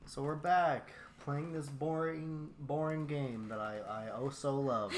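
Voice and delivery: sarcastic. Pretend. Mocking the boring game.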